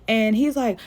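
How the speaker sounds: normal talking voice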